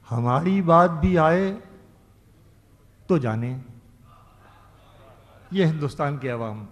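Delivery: declaiming a ghazal couplet; ghazal recitation. Acted. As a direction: recite each line like poetry on stage. Poet recites verse at mushaira.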